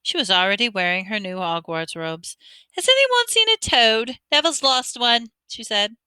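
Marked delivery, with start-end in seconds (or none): 2.8-5.3 put-on much higher, louder bossy girl; girl barging in asking about a toad. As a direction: demand it like a know-it-all. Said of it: "a very bossy sort of voice".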